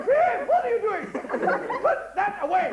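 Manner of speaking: high, laughing mock teacher; flustered teacher. Flustered teacher telling boys to put it away, high and laughing. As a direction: play the teacher losing control.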